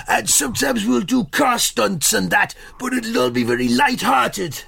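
high, plummy royal voice; King Charles impression. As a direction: pitch a TV show like the king. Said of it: "proper impression".

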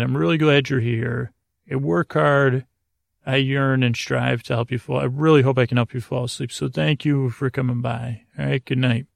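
normal talking voice

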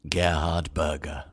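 Gerhard Berger said slowly and low, drawn out; slow low relished Gerhard Berger; savouring the name. Shown as a demonstration. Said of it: "enjoy saying the two words".

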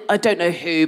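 normal talking voice

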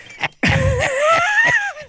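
loud high burst of laughter; real shrieking laugh; big shrieking laugh. Real, not acted. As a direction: cackle out loud at the joke. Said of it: "That was beautiful".

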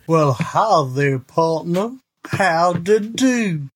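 slow, put-on Western drawl greeting a partner; a lightly voiced old-West cowboy. Host greeting his partner in a mild cowboy drawl. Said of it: "like, a cowboy voice".

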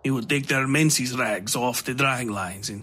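lightly voiced menacing stranger, mocking; a hint of a sly knife-wielding foreigner; sinister Gato voice. A narrator's reading. Gato's voice in story.

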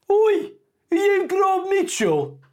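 high, loud, slow Midlands woman calling out; high woman's voice. Retold or imitated. Woman in a tuk-tuk calls to the actor, a high loud squeal. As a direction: squeal it out like a starstruck fan. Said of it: "this voice just goes to me".

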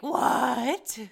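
loud, high "What?"; mock shocked shout. Podcaster imitates James's shocked outburst, loud. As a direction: blurt it like he's stunned. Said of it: "And then he's like".

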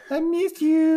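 a quick falsetto I miss you; creepy high whine. Shown as a demonstration. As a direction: copy the creepy line in a thin high voice. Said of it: "Just like that".

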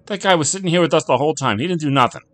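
high, loud, hurried bartender protest. A narrator's reading. Bartender protests against the accusation.

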